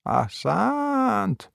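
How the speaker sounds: high, soft, long-drawn word; quiet, drawling mock praise; muttered bravo. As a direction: stretch it out slowly under the breath.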